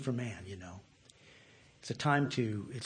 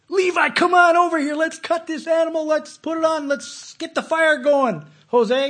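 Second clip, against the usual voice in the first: higher, louder priest calling out orders; bossy temple priest; 10 dB louder than usual. Acted. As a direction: call the helpers across the temple court. Voicing a temple priest at work.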